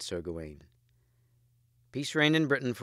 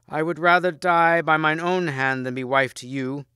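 brave queen; 5 semitones higher, 5 dB louder than usual. A narrator's reading. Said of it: "she answered him bravely".

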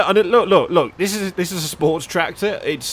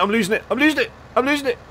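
rising panic; 7 semitones higher, faster than usual. Real, not acted. Losing control of the trailer.

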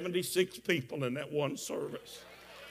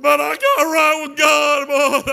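re-enacted wailing shout, much higher; crying outburst; 10 semitones higher, 14 dB louder, slower than usual. Preacher blurting his confession to friends at lunch, crying outburst. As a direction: blurt it out loud as tears break loose. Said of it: "I started crying again".

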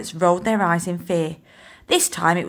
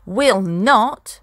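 a stubborn, louder little growl; growling back; 2 semitones higher, 6 dB louder than usual. Acted. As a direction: refuse him flatly and fiercely. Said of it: "Molly growled back".

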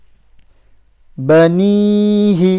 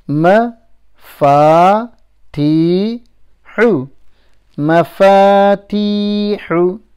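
broken into slow separate syllables; slower than usual. Shown as a demonstration. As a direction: teach each syllable apart, then join them slowly.

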